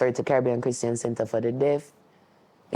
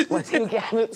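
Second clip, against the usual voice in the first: laughing; 4 dB louder than usual. Real, not acted. Laughing after father's quote.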